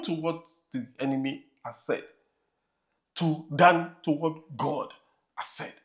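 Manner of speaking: measured sermon cadence, rising to emphasis; preaching. Acted. Pastor preaching to his congregation about the devil, firm sermon voice.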